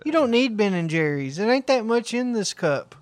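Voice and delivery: high, whiny ice-cream squabble; bickering parent and child. Acted. Man acting a mom refusing pricey ice cream, high-pitched bickering.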